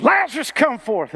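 loud, high shout to the tomb; commanding call. Acted. Jesus calls Lazarus out of the grave, commanding shout. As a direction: call out as if raising the dead.

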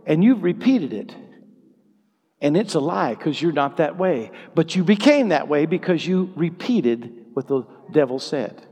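usual speaking voice

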